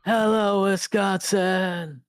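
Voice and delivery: high, slow rock-star greeting; arena shout. Acted. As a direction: call out to the crowd. Stage greeting to a crowd.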